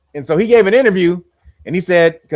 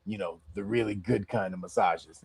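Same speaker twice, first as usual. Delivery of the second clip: low, soft, sly insinuation; sly lean-in voice; 10 semitones lower, 9 dB quieter than usual. Retold or imitated. Retelling Epstein showing the massage table, low and sly. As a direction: lean in and hint at it quietly. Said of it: "Epstein leaned in and said".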